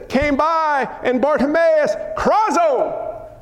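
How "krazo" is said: crying out. Acted. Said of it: "cried out".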